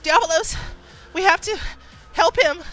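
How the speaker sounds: high, loud desperate cry; panicked, full-voiced plea; frantic. Rescued rider frantic for horse.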